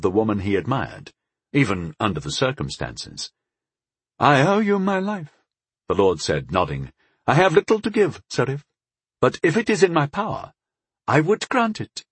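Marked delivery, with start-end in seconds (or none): 4.2-5.3 high-pitched lordly voice, grateful; grave elven lord. Acted. Rescued lord praises the brave knight who saved him, high and stately. 7.3-12.0 high, stately lord's voice; grateful elven lord. Acted. Lord offers his rescuer a reward, high and formal.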